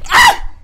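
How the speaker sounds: high piercing scream of fright; startled scream. Real, not acted. Player jolted by a jump scare, high scream. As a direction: shriek as the scare hits.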